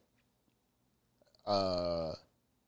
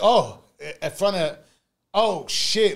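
louder dawning shock; realization; 5 semitones higher, 6 dB louder, slower than usual. Retold or imitated. Staring at the pregnancy test, realization dawning, louder. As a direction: let it hit you, oh then oh shit. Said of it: "looking at the pregnancy test like".

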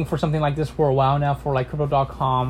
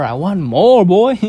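louder, higher, punching more; loud emphatic; 4 semitones higher, 9 dB louder, slower than usual. Real, not acted. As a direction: belt it out with greed. Repeats the phrase louder.

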